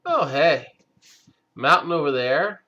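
ordinary delivery

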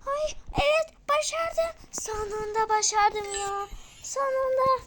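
high triumphant cheer; excited. Real, not acted. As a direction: cry out in joy. Finally succeeded, cry heard.

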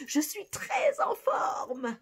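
high, loud, bursting with energy; excited. Shown as a demonstration. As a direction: shout je suis très en forme happily. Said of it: "À toi".

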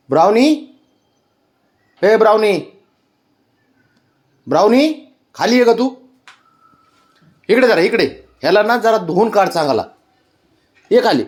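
sing-song high calling to Brownie. Real, not acted. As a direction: call her down from across the house. Calling the other dog Brownie.

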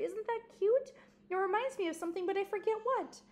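put-on mother delighting in her baby; cooing mother. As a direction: coo over the newborn. New mother cooing over baby.

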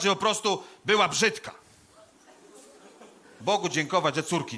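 loud, high, declaimed eulogy; hammed-up graveside orator; pompous funeral oration. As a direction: proclaim each phrase with grave ceremony and long pauses. Delivers a funeral speech.

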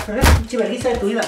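rapid, loud taunt; fast trash talk. Real, not acted. Player trash-talking the boxer, fast and loud. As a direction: rattle it off to insult the opponent.

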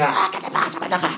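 imitated angry retort, held back in volume; understated mock outrage; mock flare-up. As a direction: act out a celebrity losing her temper, softly. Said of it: "Galilea se enciende".

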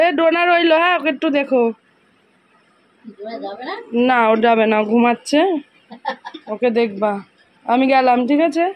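loud call to someone in another room. Real, not acted. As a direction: raise your voice to reach grandma. Calling out to grandma elsewhere. (জোরে ডেকে বলা)

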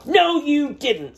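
high, loud, slow snap; a child snapping back; snapped. Acted. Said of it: "snapped Sam".

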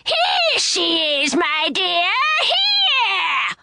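slow high shout rising to a screech on the last word; monster crying out. A narrator's reading. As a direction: call your partner to the prey. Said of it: "cried to Bobby".

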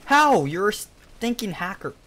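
high, loud burst of disbelief; outraged. Real, not acted. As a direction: accuse the cheater in outrage. Killed, accuses a hacker.

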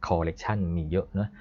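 normal talking voice

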